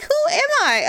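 high-pitched mock disbelief; incredulous self-questioning. Wife marvels at getting mad over sneezes, incredulous self-questioning. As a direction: question yourself with voice way up.

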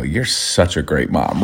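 low, soft and slow, dripping sarcasm; sarcastic. Retold or imitated. Husband retelling his snide reply to his wife, sarcastic. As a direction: murmur it as a dig. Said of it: "And I go".

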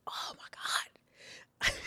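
slow, soft, real whisper; overcome whisper. Real, not acted. Speaker overcome while recalling her mistake, whispering. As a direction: breathe it out slowly, barely voiced.

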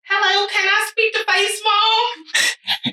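high, drawn-out phone greeting; singsong mock phone request; imitated phone caller. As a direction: put on a high, slow caller's voice.

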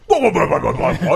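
loud, high Yorkshire blustering; mock Yorkshire accent. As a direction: ham up an outraged Yorkshireman. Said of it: "he probably said that with a".

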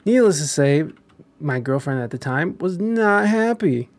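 normal talking voice

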